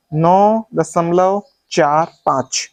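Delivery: slow, चार, पाँच as separate digits; correct reading demo. Shown as a demonstration. Teacher asking students to check, slow digit-by-digit reading. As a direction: set चार and पाँच apart slowly. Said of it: "अब मैं सही पढ़ूंगा".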